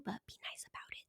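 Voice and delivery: playful whisper. Real, not acted. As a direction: whisper it like a cheeky secret.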